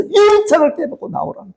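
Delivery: high-pitched urgent plea; mother's outcry. Acted. Mother urges trapped daughter to break the window, high outcry. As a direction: cry out like a mother to her child. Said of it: "그 외침을 외치는 거예요".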